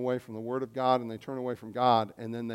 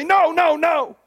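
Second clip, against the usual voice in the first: much higher and louder, a panicked parent's "No, no, no!"; alarmed parent shout; 14 semitones higher, 9 dB louder, slower than usual. Retold or imitated. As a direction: yell it like your kid just grabbed the Drano. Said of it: "grab it away from him and say".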